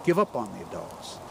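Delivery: low, measured pronouncement; lightly voiced dream whale, voice dropped; solemn whale. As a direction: say it as a grave, low verdict. Whale repeats its solemn message.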